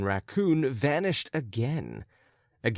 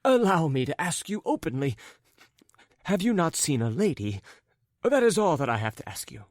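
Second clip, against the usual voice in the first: raised pitch, determined asking; resolute; 6 semitones higher than usual. Acted. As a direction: put the question firmly, in a high character voice. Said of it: "said resolutely at last".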